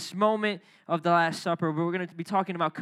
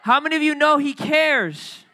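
loud, high call to the crowd; rallying call; 7 semitones higher, 8 dB louder than usual. Real, not acted. Pastor rallying the church, loud and high. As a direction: rouse the congregation with a loud call.

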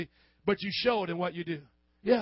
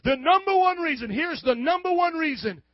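mock triumphant atheist; 7 semitones higher, 4 dB louder than usual. Voicing the atheist's big claim.

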